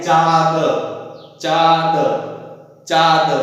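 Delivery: चाँद said as oral "chaa-d"; चाँद without the nasal. Shown as a demonstration. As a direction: drop the nasal from चाँद, giving "chaad". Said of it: "यदि इसको हटा देते हैं".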